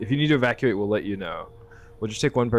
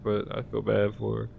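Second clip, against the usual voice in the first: normal talking voice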